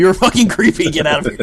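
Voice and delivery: loud mock outrage, high; mock angry. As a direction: shout him away in jest. Rejecting creepy pie ad.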